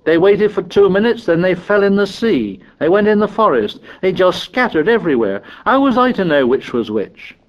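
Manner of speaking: high-pitched, aggrieved protest; indignant, pitch thrown up; indignant cry. A narrator's reading. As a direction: protest like a wronged boy. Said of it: "cried Piggy indignantly".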